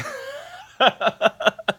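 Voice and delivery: loud high laugh. Real, not acted. Laughing after retold quote.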